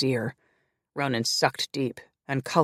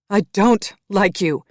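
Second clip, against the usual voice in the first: loud, high outburst; exploded; 6 semitones higher, 9 dB louder than usual. A narrator's reading. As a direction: blow up at him, slow and loud. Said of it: "she exploded".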